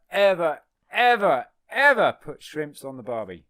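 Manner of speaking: rising, loudening repetition; building emphasis. Real, not acted. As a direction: hammer "never, ever, ever". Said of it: "building emphasis".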